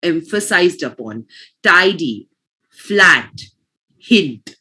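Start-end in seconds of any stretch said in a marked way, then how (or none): 1.6-4.6 老师示范读词; teacher's model words. Shown as a demonstration. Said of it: "focus on how the words are emphasized upon".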